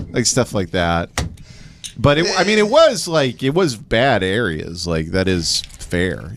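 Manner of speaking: ordinary talk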